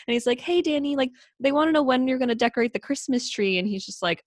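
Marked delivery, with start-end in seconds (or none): 0.4-3.6 imitating a sweet, mild-toned little brother; high, gentle kid voice; Peter. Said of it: "has the sweetest, like, most mild toned".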